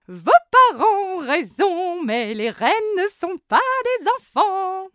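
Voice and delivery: high, loud, sugary voice; sugary candy maker. Acted. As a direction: gush about sweets.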